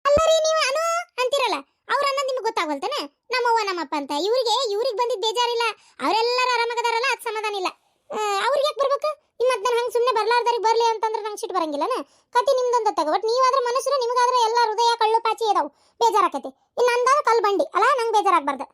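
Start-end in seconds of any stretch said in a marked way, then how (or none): 0.1-3.0 high, fast indignant retort; indignant outburst. Acted. Angry quarrel with son. 8.1-17.9 angry quarrel. Acted. Mother-in-law keeps quarrelling.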